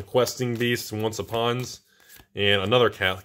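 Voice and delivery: ordinary delivery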